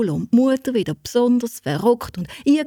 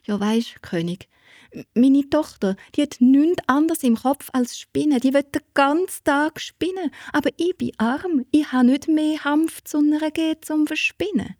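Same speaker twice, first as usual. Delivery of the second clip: higher, eager excuse-making mother voice; a hint of a flustered mother fibbing; mother fibbing to the king, nervously eager; lying mother; 8 semitones higher than usual.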